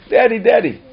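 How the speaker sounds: high, loud appeal; crying out. Retold or imitated. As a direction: call to God like a child. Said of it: "turn to Hashem and say".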